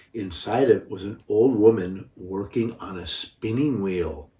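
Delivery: ordinary delivery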